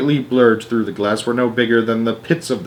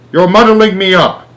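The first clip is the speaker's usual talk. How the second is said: flustered President; 8 semitones higher, 6 dB louder than usual. A narrator's reading.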